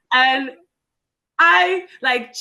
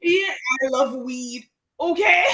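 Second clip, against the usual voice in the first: mock weed-loving suitor; 4 semitones higher than usual. Co-host mimics men she dates, mock suitor.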